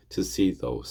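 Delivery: ordinary talk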